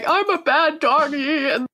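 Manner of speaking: very high, slow, squeaky puppy voice. Pretend. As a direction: whine it like a naughty little dog. Silly acted puppy line.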